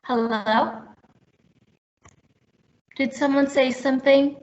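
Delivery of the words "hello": normal talking voice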